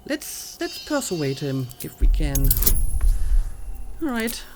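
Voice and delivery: ordinary talk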